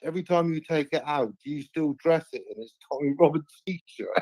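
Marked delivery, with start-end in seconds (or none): none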